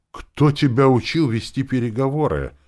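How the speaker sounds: high, loud indignant question. A narrator's reading. Woman challenging a rough negotiator, indignant. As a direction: demand it in outrage. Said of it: "спросила она возмущённо".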